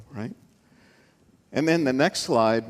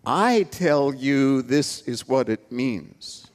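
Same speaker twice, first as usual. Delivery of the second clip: slow, firm declaration with authority; measured, certain teacher's pronouncement; Jesus; slower than usual. Retold or imitated. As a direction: lay it down slowly as final. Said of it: "Whereas Jesus was saying".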